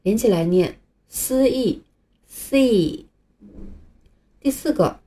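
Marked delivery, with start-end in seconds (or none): 1.1-3.1 "丝意" slowly joined into "C", see; 慢慢连读丝意成C; slow blended C. Shown as a demonstration.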